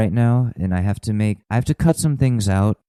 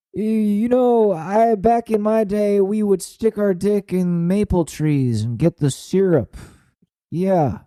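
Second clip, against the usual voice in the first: put-on much higher, slow old codger voice; put-on old-timer; 12 semitones higher, slower than usual. Old-timer recalling maple syrup days, hammed-up voice. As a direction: reminisce like a doddering old-timer.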